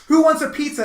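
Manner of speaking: loud, high upbeat call; cheerful offer. Retold or imitated. To everyone at the house, forced cheerful call.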